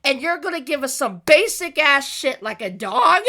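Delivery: high, loud exasperated rant; irritated. Real, not acted. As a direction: rant in outraged disbelief. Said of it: "I can't tell you how irritated I am".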